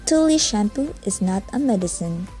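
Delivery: normal talking voice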